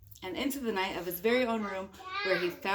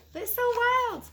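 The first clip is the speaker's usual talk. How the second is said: squealy high; 14 semitones higher, 5 dB louder than usual. Acted. Mother hams up wild things.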